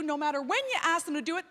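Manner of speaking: ordinary talk, nothing marked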